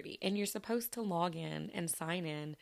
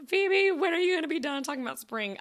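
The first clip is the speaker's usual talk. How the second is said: higher, louder mock-exasperated listener; mock complaint; 9 semitones higher, 6 dB louder than usual. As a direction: playfully imitate a fed-up listener whining. Imagined listeners tired of spring talk.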